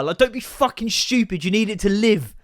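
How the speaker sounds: normal talking voice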